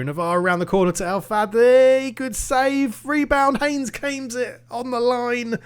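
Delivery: rising excitement at a save; excited commentary. Real, not acted. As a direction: call it like a live goalmouth scramble. Live match reaction, rising excitement.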